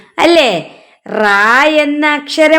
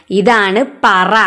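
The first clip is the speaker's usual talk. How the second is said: slow clear naming; 3 semitones lower, much slower than usual. Shown as a demonstration. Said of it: "teacher naming the picture".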